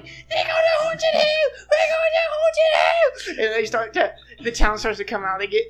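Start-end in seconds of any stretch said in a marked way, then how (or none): 0.0-3.4 very high, slow wailing shout; hysterical yell. Acted. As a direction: wail it across the village. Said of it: "She's, like, yelling".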